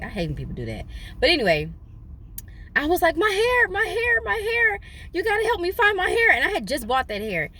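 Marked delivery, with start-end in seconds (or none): 3.2-6.3 hammed-up panic, voice climbing. Woman wailing to her friend about her lost wig.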